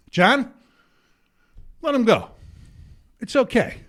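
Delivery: retold reassurance from the stage; magnanimous call-off. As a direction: call off the bouncer with measured pauses. Calling off the bouncer from stage.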